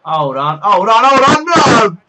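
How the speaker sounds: rising alarm. Real, not acted. Shot in the game about to go in.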